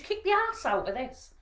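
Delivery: loud, high, defiant question; acted rallying challenge. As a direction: fire the daughter up with defiance. Rallying crying daughter, resumed.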